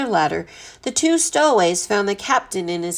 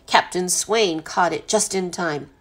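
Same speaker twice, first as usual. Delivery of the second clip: ordinary delivery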